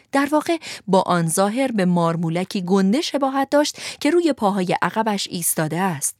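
ordinary delivery

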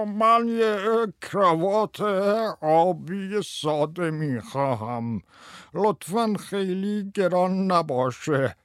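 high, slow, quavering customer; loud quavering voice. A narrator's reading. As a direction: draw it out in a high, trembling put-on voice. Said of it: "با صدای لرزان بلندی گفت".

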